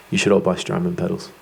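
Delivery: low-pitched joke aside; mock sales plug. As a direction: drop your voice for a tongue-in-cheek plug.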